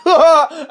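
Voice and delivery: a sudden high burst of laughter; loud laugh. Real, not acted. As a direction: burst out laughing at your own joke. Laughs at own joke.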